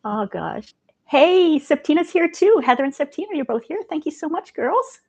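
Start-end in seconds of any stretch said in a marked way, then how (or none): 1.1-5.0 high, loud delighted hello; light up as friends arrive; excited greeting. Real, not acted. Host delightedly greeting Heather and Septina in the chat.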